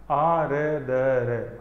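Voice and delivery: आ रे द रे spelled out very slowly; slow spelled-out sounds; syllable by syllable. Shown as a demonstration. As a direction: break आर्द्र into आ रे द रे, one sound at a time. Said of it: "इस रे पे जोर कम देना है".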